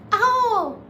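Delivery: high, slow Spanish quote; high slow cincuenta y dos; consulate lady. Retold or imitated. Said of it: "the lady's like".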